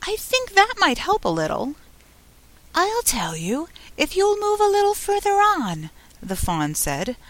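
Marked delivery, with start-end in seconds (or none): 0.0-1.8 high, tentative little suggestion; timid. A narrator's reading. Alice hoping the fawn's name might help, timidly. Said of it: "she said timidly". 2.7-5.9 high, gentle little fawn voice; soft, sweet fawn. A narrator's reading. Fawn asking Alice her name, soft sweet high voice. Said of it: "Such a soft, sweet voice it had".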